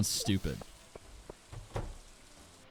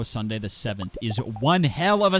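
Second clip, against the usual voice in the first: loud shout climbing much higher; hyped-up announcer; 10 semitones higher, 7 dB louder than usual. Pretend. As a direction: belt it out like a hyped sports announcer. Hyped-up announcer bit about Sunday.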